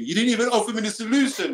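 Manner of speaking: high, loud mock protest; imagined wifely complaint. Husband voicing his wife's expected reproach, mock complaint. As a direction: imitate an indignant complaint, pitched well up. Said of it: "You're gonna say to me".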